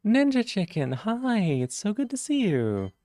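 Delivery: high, slow sing-song hello; cheery greeting. Real, not acted. Streamer greeting a viewer with a high, slow, cheery hello. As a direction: greet them brightly and drawn out.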